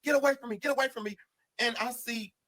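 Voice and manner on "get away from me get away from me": screaming. Retold or imitated. Inmate shrieking at friends to stay away, screaming. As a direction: shriek it over and over. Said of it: "he's saying".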